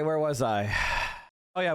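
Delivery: ordinary talk, nothing marked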